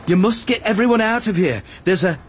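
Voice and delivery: high, pressing warning, broken off; urgent insistence. A narrator's reading. Obi-Wan telling Arad to evacuate everyone, urgent insistence.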